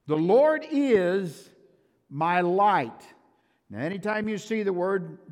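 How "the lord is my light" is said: slow, loud "The Lord is my light"; fervent preaching. Acted. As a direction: proclaim it slowly with weight. Preaching at full force.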